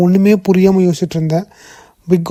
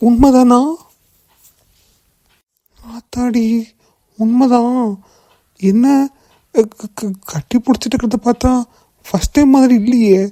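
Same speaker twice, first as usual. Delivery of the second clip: higher-pitched, halting shock; stunned disbelief; 5 semitones higher than usual. Acted. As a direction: stammer it out, stunned by what you see. Stunned onlooker sees the two hugging.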